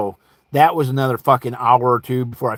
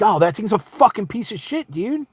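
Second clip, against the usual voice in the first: raised-pitch gripe; acted-out exasperated complaint; irritated complaint; 7 semitones higher than usual. Caller griping to seller about the scanner, irritated.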